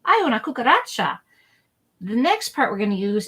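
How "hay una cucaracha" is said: hammed-up alarm, higher and louder; mock alarm. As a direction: pretend a roach scares you. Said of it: "so we can say".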